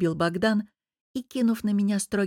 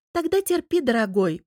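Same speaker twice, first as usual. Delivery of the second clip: much higher, sugary mock tenderness; fake sweet cooing; 5 semitones higher, 3 dB louder than usual. A narrator's reading. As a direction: coo it with false sweetness. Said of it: "притворно ласково проворковала я".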